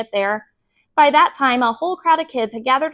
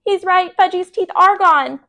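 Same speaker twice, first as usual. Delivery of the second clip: alarmed; 8 semitones higher, 4 dB louder than usual. A narrator's reading. Sheila alarmed.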